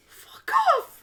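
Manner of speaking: high, loud mock outburst; indignant retort. Retorting to people who say they sound southern, mock indignant outburst. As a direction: squawk it in mock outrage. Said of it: "I'm like".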